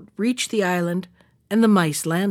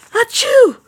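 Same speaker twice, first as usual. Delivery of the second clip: even higher, louder sneeze; bigger sneeze; 14 semitones higher, 8 dB louder than usual. Acted. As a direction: let the second sneeze explode. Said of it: "sneezed the old man".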